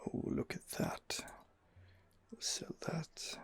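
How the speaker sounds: ordinary talk, nothing marked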